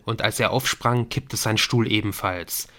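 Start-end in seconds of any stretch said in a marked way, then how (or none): none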